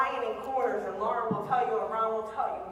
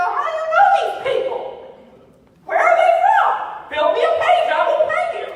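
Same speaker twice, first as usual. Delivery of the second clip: excited friends; 15 dB louder than usual. Retold or imitated. Friends amazed, begging for help.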